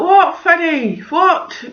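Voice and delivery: re-enacted impatient response; exasperated mum. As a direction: answer a pestering child while busy. Harassed mum answering shouting child.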